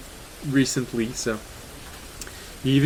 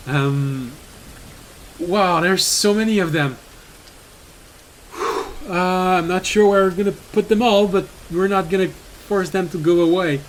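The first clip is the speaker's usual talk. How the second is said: usual speaking voice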